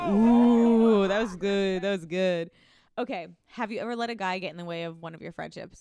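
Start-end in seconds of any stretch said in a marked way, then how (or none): 0.0-2.5 loud, hammed-up sound effect; over-the-top canned sound effect. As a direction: ham it up like a cheesy soundboard clip. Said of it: "put some sound effects like".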